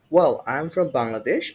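ordinary delivery

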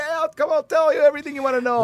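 high, fast, eager mock voice; eager Bucko. Bucko answering newspapermen, high, fast and eager. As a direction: blurt it out like he can't wait to talk. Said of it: "And Bucko's going".

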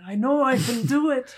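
lightly voiced mad scientist; a hint of a manic basement inventor; mock mad scientist muttering to himself. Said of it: "a mad scientist in the basement going".

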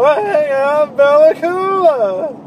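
high, loud, very slow put-on celebrity voice; Rob Schneider voice. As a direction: stretch each word out high and loud. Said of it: "that's pretty much what Rob Schneider sounds like".

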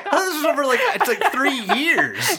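high-pitched incredulous outburst; real baffled, giggly squawk; laughing disbelief. Real, not acted. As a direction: protest in astonishment through laughter. Astonished reaction to one-week timeline.

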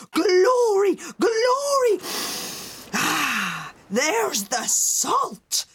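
loud, high jubilant shouts, then a savoured sigh; triumphant cry. A narrator's reading. Mother catching the scent of the sea, crying triumphantly. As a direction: exult loudly, then draw out the last words. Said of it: "cried his mother triumphantly".

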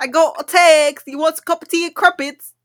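mock British accent. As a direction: put on a badly faked British accent. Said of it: "I don't know how to do accents".

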